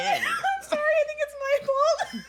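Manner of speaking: high squeal of helpless laughter; real laughing. Real, not acted. Cohost apologising for starting the skin joke, laughing helplessly. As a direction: apologise while cracking up, loud and high.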